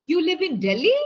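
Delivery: "Delhi" swept upward at the end; rising question tone. Shown as a demonstration. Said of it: "I'm asking a question".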